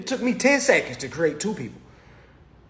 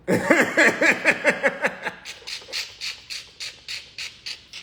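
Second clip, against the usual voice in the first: breathless silent laugh; airy giggle; unvoiced wheezing laugh; 5 semitones higher than usual. Real, not acted. Laughing at own rant.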